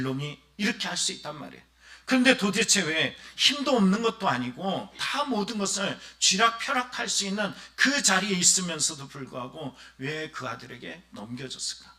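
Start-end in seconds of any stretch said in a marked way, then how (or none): none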